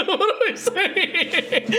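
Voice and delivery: high, slow words through real laughter; laughing at oneself. Real, not acted. Streamer catches own contradiction, laughing. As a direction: crack up at your own nonsense.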